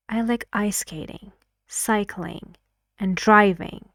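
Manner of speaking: "skating", "cycling", "driving" each fall, "driving" stressed hardest; all-falling list. Shown as a demonstration. Teacher shows the second possibility, all falling intonation. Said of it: "I used all of them with falling intonation".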